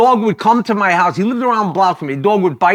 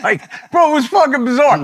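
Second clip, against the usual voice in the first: laughing; 9 semitones higher than usual. Real, not acted. Laughing at the memory.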